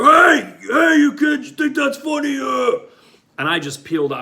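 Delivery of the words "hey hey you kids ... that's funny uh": put-on high, loud yell at the kids; angry shout. Store owner yelling at the kids who hit his door, angry shout. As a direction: bellow at the fleeing kids. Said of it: "the owner of the party store was like".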